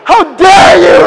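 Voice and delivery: loud, high hammed-up outrage; mock indignant shout.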